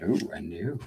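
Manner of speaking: usual speaking voice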